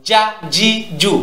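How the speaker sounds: "জা জি জু" short and sharp, each separate; "জা জি জু" with no lengthened vowels; sharp short syllables. Shown as a demonstration. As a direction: say "জা", "জি", "জু" as crisp bursts. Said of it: "উচ্চারণটা তীক্ষ্ণ হয়".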